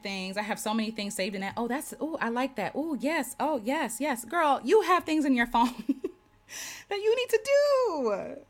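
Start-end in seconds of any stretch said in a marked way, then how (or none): 1.6-4.2 higher-pitched mock delight, oohing along; hooked and approving, lightly hammed-up; acted gushing. Acting out herself gushing over saved posts. 4.2-8.4 high, exasperated sing-out with a laugh; laughing, hammed-up reprimand; mock scolding. Mock-scolding herself over her phone.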